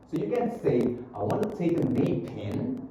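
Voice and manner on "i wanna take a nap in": "nap in" run together as "na-pin"; linked words. Shown as a demonstration. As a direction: carry the P of "nap" into "in". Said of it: "Nah, itu disambung tuh".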